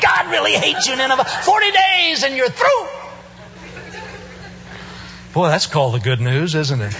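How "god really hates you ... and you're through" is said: high, shouted hellfire preaching; mock prophet yelling doom at Nineveh; fiery sermon. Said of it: "preaches his favorite sermon".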